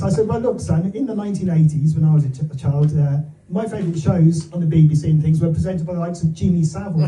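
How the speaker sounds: normal talking voice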